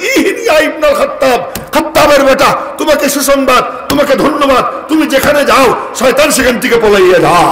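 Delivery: proud praise. Retold or imitated. Prophet praises Umar. (উমরকে প্রশংসা করে উঁচু গলায় ঘোষণা)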